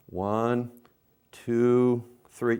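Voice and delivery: "One, two, three" stretched out with long gaps; string the count out slowly. Shown as a demonstration. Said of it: "how I counted much slower".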